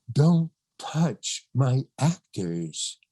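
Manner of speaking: very slow, deliberate command; John Huston's stern warning. Retold or imitated. Director repeating his order to the casting director, slow stern warning. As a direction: space out every word with authority. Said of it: "Just like that".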